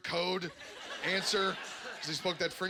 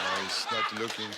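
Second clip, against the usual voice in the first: low sneaky aside; sneaky Mountie; 8 semitones lower, 3 dB louder than usual. Acted. Mountie watching the gunman, low sneaky aside. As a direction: spot your chance to pounce.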